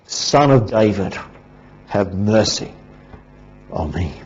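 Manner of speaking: ordinary talk, nothing marked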